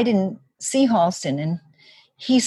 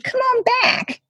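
high beckoning. Retold or imitated. Southern assistant ushering her in.

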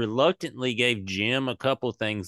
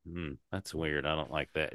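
quiet, lower and flat, like a cold audience; a much quieter, lower, unimpressed hmm; mock unimpressed crowd; 6 semitones lower, 10 dB quieter than usual. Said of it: "And then they're like".

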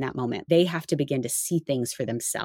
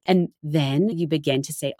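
normal talking voice

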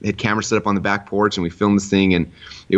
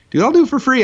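very high, louder keen offer; eager buddy; 5 dB louder than usual. Retold or imitated. Retelling buddy Nate agreeing to be filmed, eager. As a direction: imitate a friend leaping at the chance.

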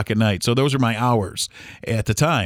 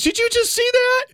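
imitating her, much higher and strained; horrified mother-in-law. Mother-in-law watching the second plane, imitated horror. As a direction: retell her horrified question up high. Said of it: "And she goes".

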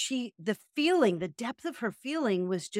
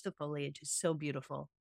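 soft dreamy foliage; 6 semitones lower, 10 dB quieter than usual. Shown as a demonstration. Host savoring the autumn leaves, trailing off softly. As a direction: let the word foliage fade into a soft, awed hush. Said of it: "These, the foliage".

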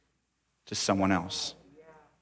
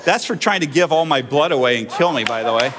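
ordinary delivery

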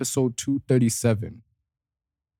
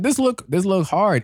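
impressed; 8 semitones higher than usual. Retold or imitated. Retold impressed reaction.

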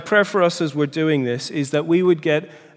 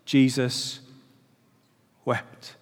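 softer, slow and grave, long pause before "wept"; solemn quote; 3 semitones lower, 6 dB quieter, much slower than usual. Real, not acted. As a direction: let "Jesus" hang, then drop "wept" almost to nothing. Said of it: "that little tiny verse".

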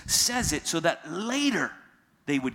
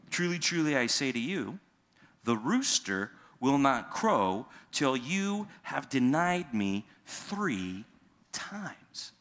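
solemn prophecy; 2 semitones lower, slower than usual. Pretend.

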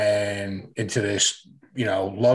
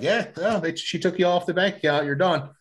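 higher, lightly voiced dismissive bank clerk; 6 semitones higher than usual. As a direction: brush him off like a bored teller, pitched up. Bank clerk voiced in retold story.

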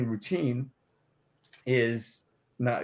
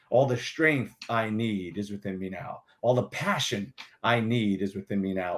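incantation; 3 semitones lower than usual. Shown as a demonstration. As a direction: intone it like a ritual chant. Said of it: "is all as an incantation".

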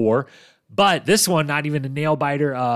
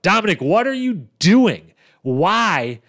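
raised, louder cry of disbelief at a blunder; exasperated disbelief; 6 semitones higher, 6 dB louder, slower than usual. Real, not acted. Podcast host exasperated at Dominic's elimination blunder, disbelieving cry. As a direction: throw up your hands, what are you doing.

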